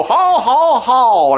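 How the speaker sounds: high hammed-up jeering laugh; mock scoffing laugh. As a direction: cackle like a TV audience scoffer. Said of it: "And they go".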